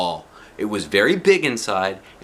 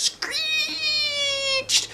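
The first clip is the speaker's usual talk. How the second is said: high, slow screech on the words; screeching brakes; 3 dB quieter than usual. A narrator's reading. Reader imitating the bus brakes with a long, high screech. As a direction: stretch it out like squealing brakes.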